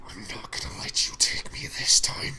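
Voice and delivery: low, whispery demon growl; deep, voiceless demonic threat. Acted. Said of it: "this deep fucking, like, demonic voice".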